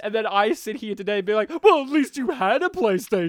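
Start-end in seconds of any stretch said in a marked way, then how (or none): 1.6-3.3 loud, high sarcastic taunt; mocking sneer. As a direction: jeer it at a sulking kid. Mocking dismissive adult.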